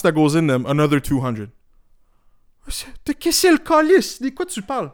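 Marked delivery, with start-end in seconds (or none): 3.0-4.9 high, shocked cry in French; outraged Québécois exclamation. Retold or imitated. A man scoffing at glasses prices, in high mock outrage.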